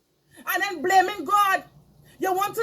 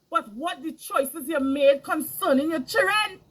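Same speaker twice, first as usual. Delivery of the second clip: ordinary talk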